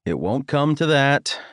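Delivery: ordinary delivery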